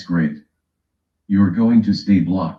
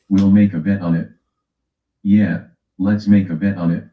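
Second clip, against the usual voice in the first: usual speaking voice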